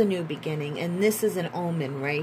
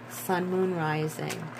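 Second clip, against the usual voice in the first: usual speaking voice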